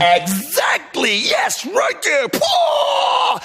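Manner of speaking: high, slow triumphant yell; excited shout. Acted. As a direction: cheer the hit. Hyped over groin kick.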